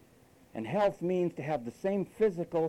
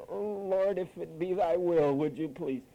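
mock timid prayer. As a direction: plead meekly like a timid believer, mockingly. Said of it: "I don't have to come and say".

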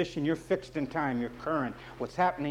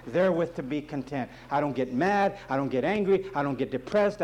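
ordinary delivery

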